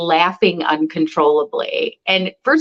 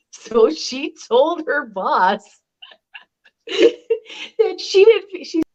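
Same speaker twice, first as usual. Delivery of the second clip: laughing through the words, dragged out and higher; cracking up; 8 semitones higher, slower than usual. Real, not acted. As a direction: barely get the story out for laughing. Cracks up telling friend's story.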